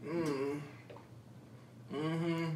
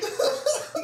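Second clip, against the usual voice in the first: burst of laughter. Real, not acted. Friend laughs at reaction.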